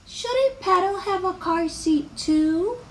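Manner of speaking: high, slow fretting; little worrier imagining the worst; anxious worrying. Acted. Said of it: "always she worried about her doll".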